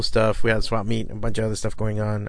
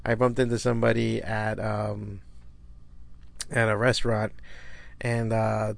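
ordinary delivery

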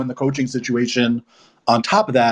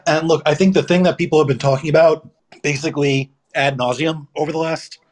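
ordinary delivery

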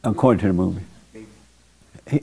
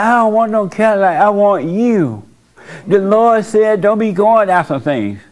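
clingy mama; 6 semitones higher than usual. Retold or imitated. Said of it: "He's like".

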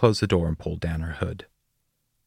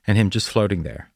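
plain reading voice

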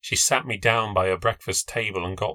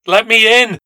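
high, loud desperate plea; loud pleading; 9 semitones higher, 10 dB louder than usual. A narrator's reading. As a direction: beg through the glass at full voice. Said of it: "I pleaded".

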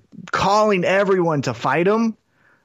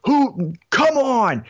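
giddy; 6 semitones higher, much slower than usual. Retold or imitated. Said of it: "open the door with giddiness".

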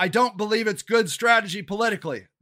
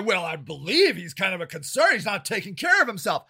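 concerned critic; 5 semitones lower, 4 dB quieter than usual. Retold or imitated. Imitating people who doubt him.

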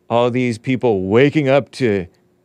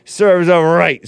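Carver's voice; 7 semitones higher, 5 dB louder, slower than usual. Retold or imitated. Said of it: "says Carver".

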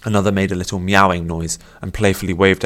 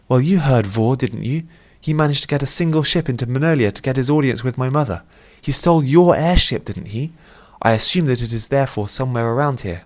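higher-pitched princess voice; a princess laying out the facts; reasoning princess; 6 semitones higher, 3 dB louder than usual. A narrator's reading. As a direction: lift the pitch into a mildly regal character.

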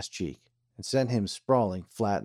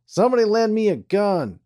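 angry demand; 12 semitones higher, 7 dB louder than usual. A narrator's reading. Embarrassed Charlie demands gun.

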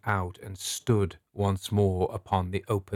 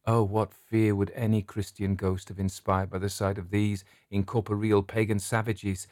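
plain reading voice

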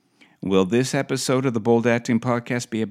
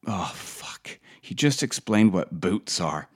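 normal talking voice

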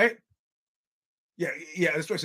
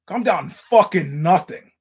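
louder, slow, emphatic comeback; angry retort; 8 dB louder, slower than usual. Retold or imitated. Comic retelling his comeback to an armed heckler, angrier and louder. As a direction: lean hard into an indignant retort.

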